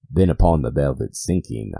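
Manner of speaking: plain reading voice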